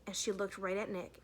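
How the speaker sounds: plain reading voice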